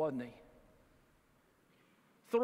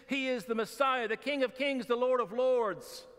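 building proclamation; 7 semitones higher, 4 dB louder than usual. Acted. Preacher proclaims Jesus' titles.

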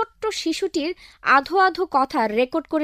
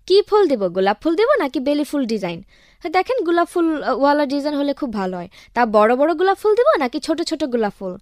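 rattling off options; much faster than usual. Acted.

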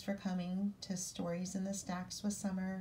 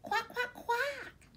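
much higher, tiny duckling voices; squeaky little baby duck quacking; high duckling quacks; slower than usual. Acted. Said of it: "say the ducklings".

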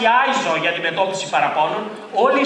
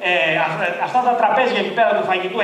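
ordinary talk, nothing marked

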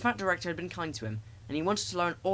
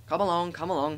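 eager engine puffs, slightly raised; puffing; 4 semitones higher, 3 dB louder than usual. A narrator's reading. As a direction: chug the words out in rhythm. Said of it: "he puffed".

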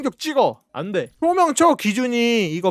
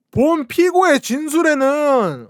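slow prosecutor voice; 5 semitones higher, 4 dB louder, slower than usual. Pretend. Mock prosecutor in court.

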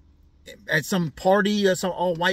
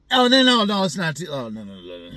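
disgusted protest; 4 dB louder than usual. Real, not acted. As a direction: back away from the idea out loud. Recoils from the pool scene.

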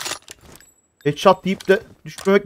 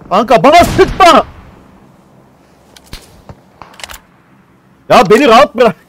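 exasperated shout; 4 semitones higher, 7 dB louder than usual. Real, not acted. Begging a teammate to quit shooting, loud and exasperated. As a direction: yell at him to leave you alone.

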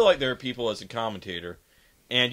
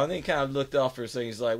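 normal talking voice

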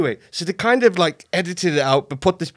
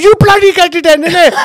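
put-on higher, louder Indian accent, laughing; dad's Indian accent; 11 semitones higher, 8 dB louder than usual. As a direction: snap back in his father's Indian accent. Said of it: "I end up sounding like my dad. He's got an accent".

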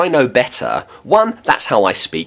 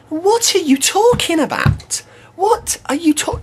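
much higher, disbelieving; a hint of exasperated disbelief; incredulous outburst. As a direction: squeak up in disbelief. Incredulous at pedant's claim.

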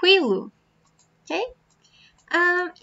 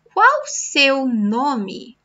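slow model question; 3 dB louder, much slower than usual. Shown as a demonstration. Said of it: "teacher modelling a question slowly".

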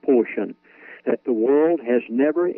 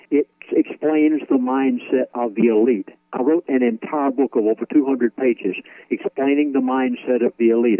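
ordinary talk

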